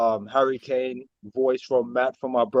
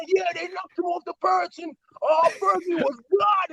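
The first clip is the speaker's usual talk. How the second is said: very high mock-gushing fan voice; imitated boasting dad. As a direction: mimic dad raving, pitched way up. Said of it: "And he was just going".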